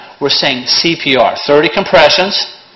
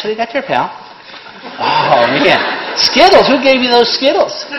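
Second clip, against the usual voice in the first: put-on baby talk; 6 semitones higher than usual. Cooing to a baby.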